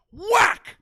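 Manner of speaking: loud, high shouted whack; slap sound. Retold or imitated. Retelling dad punching the bully's father, shouted whack. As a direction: bark out the slap like a hit. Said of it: "my dad goes".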